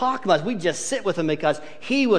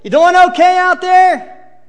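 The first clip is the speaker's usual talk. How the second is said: much higher and louder, calling out cheerfully; friendly call to the boat; 10 semitones higher, 9 dB louder than usual. Acted. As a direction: shout a friendly check-in across the water. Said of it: "Jesus doing".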